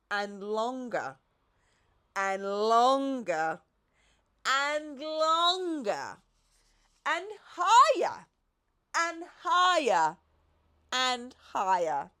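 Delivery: slow, building and rising each time; growing longer. A narrator's reading. The narrator builds as the giraffe's neck grows. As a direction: stretch each word further. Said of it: "neck grows longer".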